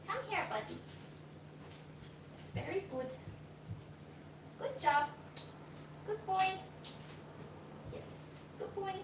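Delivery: high, soft praise for a pet. Real, not acted. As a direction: coo at the dog. Praising pet during training.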